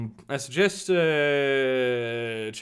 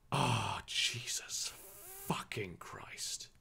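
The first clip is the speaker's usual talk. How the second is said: exasperated whisper; 7 semitones lower, 13 dB quieter, much slower than usual. Real, not acted. Exasperated at news.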